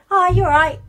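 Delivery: lightly voiced nan, high and loud, casual; nan's normal hi. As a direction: drop the posh voice, chatty and relaxed. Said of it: "once she knew it was me".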